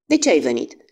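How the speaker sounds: a mild, understated outburst; a touch of a girl bursting out at a stranger; sudden outburst. Acted. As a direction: blurt the question out. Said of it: "izbucni pe neașteptate".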